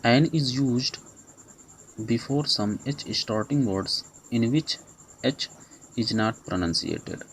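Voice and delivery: very slow, each word set apart; slow rule dictation. Shown as a demonstration. Teacher reading a grammar rule to students, very slowly. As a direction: read the rule out slowly for notes.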